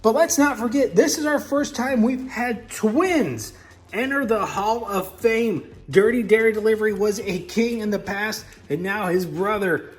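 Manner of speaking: crown the king like a grave master of ceremonies; solemn coronation host; solemn ceremony voice. Acted.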